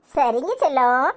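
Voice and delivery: low, slow, loving agreement; tender mother. Acted. Mother to her darling children, tenderly agreeing. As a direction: give in gently and unhurriedly.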